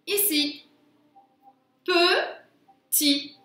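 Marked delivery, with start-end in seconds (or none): 1.8-3.4 "Petit" split into pe, pause, ti; two high, separate beats on "Petit"; syllable by syllable. Shown as a demonstration. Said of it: "J'entends le son I à la fin".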